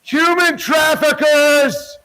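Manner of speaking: drawn-out high shout of accusation. Real, not acted. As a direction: yell it slowly across the street.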